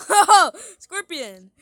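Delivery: startled laughing shriek; screaming at a spider. Real, not acted. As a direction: yelp in fright, then laugh. Sees a scorpion.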